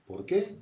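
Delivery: "¿Por qué?" with qué jumping high; rising question. Shown as a demonstration. Teacher shows the students the rising question, high on qué. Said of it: "Je monte un peu vers les aigus".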